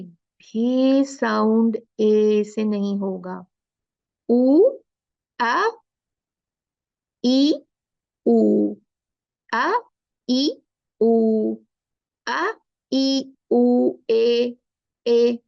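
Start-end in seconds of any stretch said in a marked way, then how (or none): 4.3-15.4 loud, clearly separated vowels ऊ, आ, ई, then ए; vowel drill. Shown as a demonstration. Teacher drills the class on Quranic vowels, loud and distinct. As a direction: chant each vowel distinctly and firmly. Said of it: "समझ आई?".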